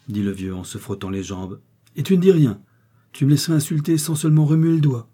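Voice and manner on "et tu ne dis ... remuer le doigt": high, loud bitter scolding; angry reproach. A narrator's reading. Wife scolding her husband for not defending her, angry and loud. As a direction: lash out at him for doing nothing.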